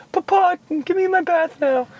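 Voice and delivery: put-on very high, pleading little British boy; the dog begging in a squeaky British kid voice. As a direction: beg for food like a tiny British boy. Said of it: "like, a British boy".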